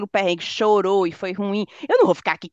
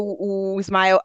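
ordinary talk, nothing marked

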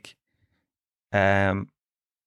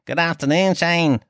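hammed-up Leeds accent, pitched higher throughout; 5 semitones higher than usual. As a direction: lay the mock Leeds accent on thicker. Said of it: "Is that even a Leeds accent?".

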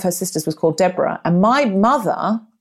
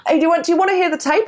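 chirpy offer; 11 semitones higher, much faster than usual. Retold or imitated. Investigator offering tape.

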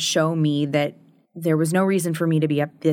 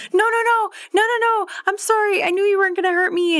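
much higher, hurried imitation of the sister; imitating frantic apology; faster than usual. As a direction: squeak out a rush of reassurance. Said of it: "she was like".

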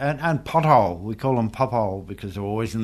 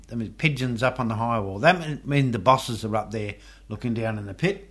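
ordinary delivery

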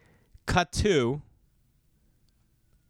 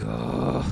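disgusted groan. Retold or imitated. Disgusted at dropped Coke bottle.

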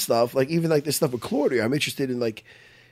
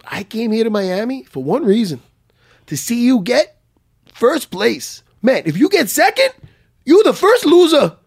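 put-on stern accented coach, high and building louder; Renzo's accent; 8 semitones higher, 7 dB louder than usual. Coach warns his student before the tournament, sternly, in an accent. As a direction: lay down the law in an imitated accent, rising to a loud finish. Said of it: "Renzo can be stern".

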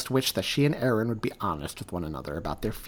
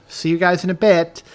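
called farewell; 8 semitones higher, 12 dB louder than usual. Acted.

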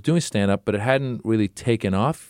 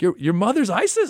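put-on confused kid voice; 11 semitones higher than usual. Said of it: "kid from Wisconsin was like".

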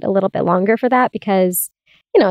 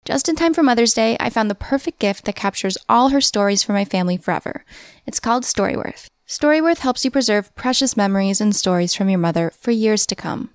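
ad read; 3 dB quieter than usual. Acted. Host reads a sponsor ad.

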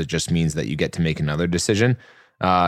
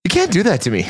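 mock pleading protest; 11 semitones higher, 3 dB louder than usual. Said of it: "I go".

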